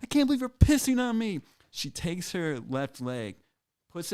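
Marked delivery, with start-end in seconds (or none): 0.0-1.4 shrieking high and loud; mock scream. Girlfriend being peed on screams, high shocked shriek. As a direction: scream it in shocked disbelief, pitched way up. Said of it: "she screams".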